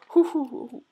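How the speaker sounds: loud anxious hoo breaths; bracing before checking the answers; nervous huffing breaths. Acted. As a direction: puff out hoo hoo in mock panic. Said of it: "I'm scared".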